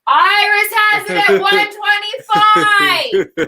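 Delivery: slow, loud, high shout over the noise; yelling. Real, not acted. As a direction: bellow it out slowly. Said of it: "Why are you yelling?".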